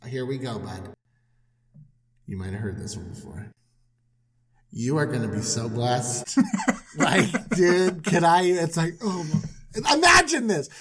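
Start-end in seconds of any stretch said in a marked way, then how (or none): 0.1-6.4 low, hushed and fast; God quietly pitching blessings to Abram; confiding God. Retold or imitated. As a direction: lean in like sharing a secret.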